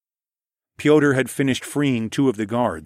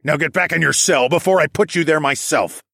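stern order; 6 semitones higher, 5 dB louder than usual. A narrator's reading. Dragon orders prisoner into cell.

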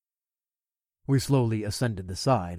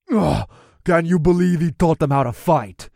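louder, higher disgusted orc; 4 semitones higher, 6 dB louder than usual. Acted. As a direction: gag on it like a revolted orc. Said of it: "another orc gagged".

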